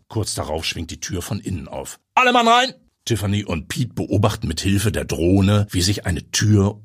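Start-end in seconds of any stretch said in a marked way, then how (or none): 2.1-2.7 high, loud order to storm; shouted command. Acted. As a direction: bellow it at the squad. Police chief ordering storming.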